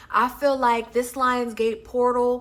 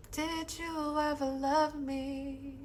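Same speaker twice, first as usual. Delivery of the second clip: higher, even softer, slow; fading echo; 4 semitones higher, 9 dB quieter, slower than usual. Acted. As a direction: let it fade away like an echo. Echoes the same line softer.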